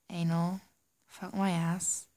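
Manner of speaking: ordinary talk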